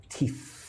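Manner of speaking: soft "Teeth" with a long final th; teacher isolating the th in "Teeth"; tongue on teeth. Shown as a demonstration. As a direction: end "Teeth" on a held, hissed th, tongue against the teeth. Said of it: "Put your tongue on your teeth".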